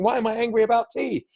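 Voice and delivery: puzzled by your own anger over tea; baffled at yourself. Retold or imitated. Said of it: "And you're thinking".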